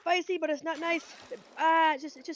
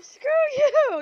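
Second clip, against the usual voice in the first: playful shout; 14 semitones higher, 14 dB louder than usual. Real, not acted. Playful yell at partner.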